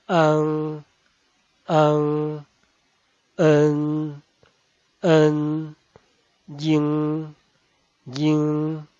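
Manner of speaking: an, en, yin, won, yang with a long stressed nasal; Mandarin back nasals. Shown as a demonstration. Teacher demonstrates back nasals, drawn out and stressed. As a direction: stretch the ng ending and lean on it. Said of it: "son más alargada y acentuada".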